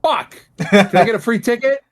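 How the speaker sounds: hamming up a begging fan; high, eager mock plea for a free ticket; cheeky begging. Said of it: "And I'm like".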